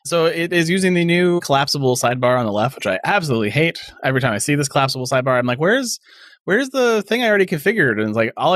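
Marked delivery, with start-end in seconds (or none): 5.6-7.9 high-pitched, exasperated searching; mock exasperation. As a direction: act out a frustrated user hunting around. Acts out exasperation at the sidebar.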